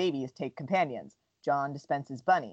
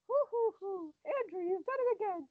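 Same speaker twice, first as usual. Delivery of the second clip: high, softer doting granny voice; Nana voice; 5 dB quieter than usual. Retold or imitated. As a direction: coo it like a proud old grandma. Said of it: "Nana voice".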